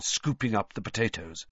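plain reading voice

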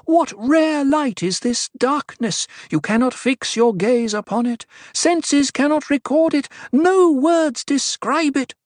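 high, ringing oracle's voice; impassioned priestess in full cry; booming proclamation. A narrator's reading. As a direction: proclaim it to the whole congregation. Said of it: "Her amplified voice boomed".

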